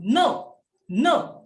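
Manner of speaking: a short scolding NO that drops sharply; fourth tone NO. Shown as a demonstration. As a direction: stamp out NO high, then let it fall. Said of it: "seperti kita membentak seseorang".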